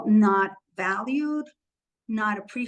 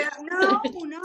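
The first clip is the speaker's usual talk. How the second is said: real laughter; 8 semitones higher than usual. Real, not acted. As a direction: burst out laughing at the tease. Laughs at friend's teasing.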